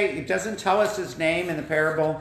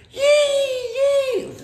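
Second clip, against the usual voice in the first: very high, loud squealing cheer; joyful cheer; 5 dB louder than usual. Acted. Forgiven man jumps for joy, very high squealing cheer. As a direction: squeal with delight.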